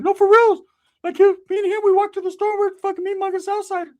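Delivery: high, loud excited squeal; excited Ricky. Retold or imitated.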